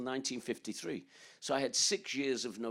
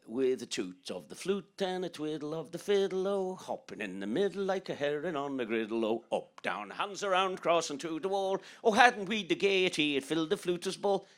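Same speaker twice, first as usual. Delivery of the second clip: put-on lightly sung Irish accent, rising louder; 5 semitones higher, 4 dB louder than usual. Boy reciting Phil the Fluter's Ball for the concert crowd, Irish accent. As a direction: chant it with a light Irish lilt. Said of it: "I did it in an Irish accent".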